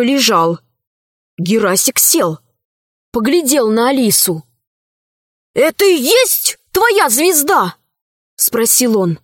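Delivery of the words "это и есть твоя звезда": удивлённо. A narrator's reading. Boy asks in awe.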